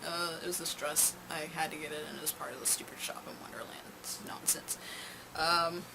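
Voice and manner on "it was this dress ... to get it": ordinary talk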